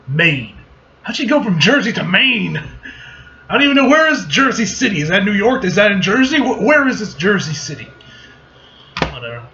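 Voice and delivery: ordinary talk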